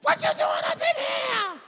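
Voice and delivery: very high, loud screamed question; screaming demon. Acted. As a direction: shriek it as an alarmed demon. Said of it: "He started screaming".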